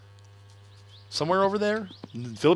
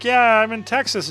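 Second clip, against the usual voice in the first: higher, louder Pee-wee impression; imitating Pee Wee Herman; 9 semitones higher, 6 dB louder than usual. Retelling a Pee-wee bit to chat: goofy high impression. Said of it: "he's like".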